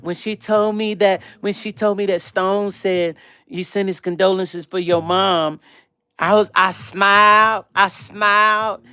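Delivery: slow, dreamy mock of a smitten woman; lightly voiced smitten Shayna; imitating Shayna. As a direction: drag it out like she's swooning. Imitates Shayna retelling her reaction.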